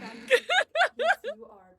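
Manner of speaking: words squeezed out through loud laughter; laughing. Real, not acted. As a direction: crack up while trying to talk. Cracking up mid-quote.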